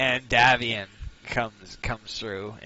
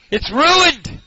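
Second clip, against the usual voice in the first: angry shout; 9 dB louder than usual. Acted. NPC yelling from other room.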